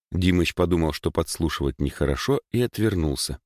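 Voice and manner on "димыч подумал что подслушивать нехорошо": ordinary delivery, nothing marked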